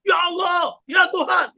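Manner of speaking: high, shouted cries of oh my God; imitating people calling on God in alarm; mock panicked cry. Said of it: "mereka bilang".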